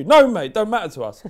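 angry. Real, not acted. Rant climax.

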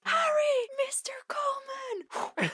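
high strained whispered yell; frantic warning cry. Retold or imitated. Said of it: "pushes them to the ground".

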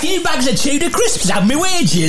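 high, shouty comic Geordie; Geordie Brucey impression. Host doing Brucey for laughs, shouty Geordie accent. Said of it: "Can you do Brucey in a Geordie accent?".

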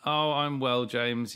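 ordinary talk

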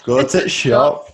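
slow, hammed-up Yorkshire accent; drawn-out northern "go to shop". Shown as a demonstration. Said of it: "I just put T in front of everything".

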